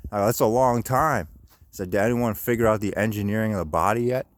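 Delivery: ordinary delivery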